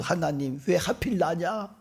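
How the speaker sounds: acted cry of protest, rising to a loud high wail; anguished wailing. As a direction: imitate a parent crying out to God. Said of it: "울부짖고 절규하면서".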